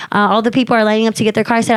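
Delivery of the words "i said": usual speaking voice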